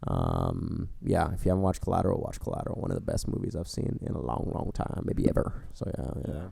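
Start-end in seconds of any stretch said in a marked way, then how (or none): 2.2-6.5 trailing off quietly. Real, not acted. Quiet trailing movie recommendation.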